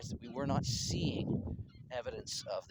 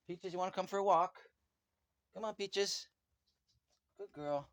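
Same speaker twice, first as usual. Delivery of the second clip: higher-pitched coaxing call; calling a dog; 8 semitones higher, 3 dB louder than usual. Real, not acted. Owner asks Peaches along for a walk, coaxing call. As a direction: call the pet over in a high voice.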